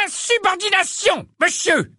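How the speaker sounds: very high-pitched outraged protest; pompous wizard's high outburst; indignant roar. A narrator's reading. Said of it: "rugit un sorcier corpulent".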